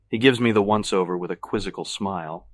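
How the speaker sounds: usual speaking voice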